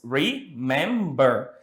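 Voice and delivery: "Remember" slowly split into re, mem, ber; syllable by syllable. Shown as a demonstration. Teacher counting out three syllables for students, "Remember" slowly split. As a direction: break "Remember" into three separate beats. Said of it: "has three syllables".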